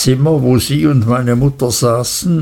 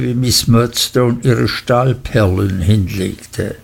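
ordinary delivery

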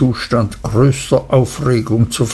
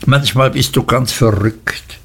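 ordinary delivery

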